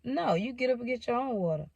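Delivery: light, high-pitched teasing comeback; high and teasing, at easy volume; playful retort. Retold or imitated. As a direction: replay it as a playful refusal. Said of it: "in a playful way".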